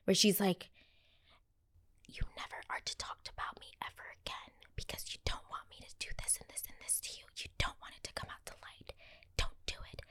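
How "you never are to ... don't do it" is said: eerie whispered rant; ASMR whisper. Retold or imitated. Vlogger imitating [name]'s song outro, creepy whisper. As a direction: breathe every word right at the mic. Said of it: "this weird A S M R rant".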